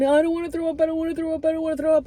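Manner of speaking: high, whiny repeat; high mock-pleading chant; mock whining. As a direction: parody her own whine. Mocking her own whining.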